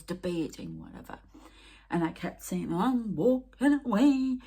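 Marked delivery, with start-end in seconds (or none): 2.7-4.4 high, loud, drawn-out announcement; retold defiant refusal. Woman retelling her walkout on the spirits, drawn-out loud defiance. Repeats what she kept telling spirits.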